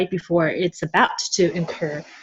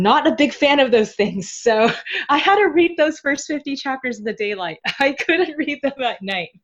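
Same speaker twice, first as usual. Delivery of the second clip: higher pitch, words broken by giggles; laughing while talking; 7 semitones higher than usual. Real, not acted. Admitting to the co-host she read horror chapters only in daylight, laughing. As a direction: chuckle through an embarrassed confession.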